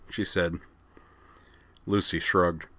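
usual speaking voice

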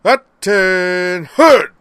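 drawn-out loud high bellow; slow parade-ground roar; harsh command. Acted. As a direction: bark the room to attention. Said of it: "a harsh voice suddenly cut in".

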